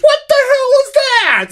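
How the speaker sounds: very high incredulous yelp; squealed mock outrage at something absurd; retold yell. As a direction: re-enact a baffled outburst, voice shooting up. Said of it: "I yelled in the middle".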